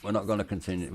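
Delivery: ordinary talk, nothing marked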